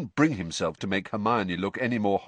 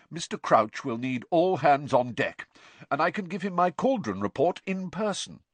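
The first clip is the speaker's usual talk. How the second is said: self-important; 9 semitones higher than usual. Acted. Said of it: "said Percy importantly".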